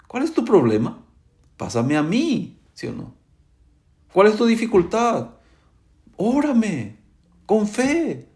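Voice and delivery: high-pitched, insistent sermon delivery; fervent pulpit appeal in a high voice; impassioned preaching. Acted.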